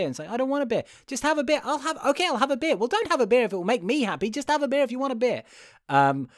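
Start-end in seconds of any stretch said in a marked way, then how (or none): 0.3-0.8 refusing son. Retold or imitated. Son refuses the beer, exasperated. 1.1-2.8 much higher, mock-pleading dad voice; hammed-up dad nagging his son to drink; insistent dad. Dad pressing the son to have a beer, high and pleading. 2.8-5.4 high and fast, mock-exasperated; exasperated son. Son tells his dad to drop the beer, high and fast.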